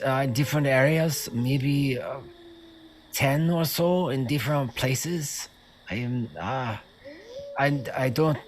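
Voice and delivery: put-on foreign-accented guard, unsure and faltering; hesitant captive guard. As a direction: stammer out a reluctant, halting answer. Plays the captive guard answering.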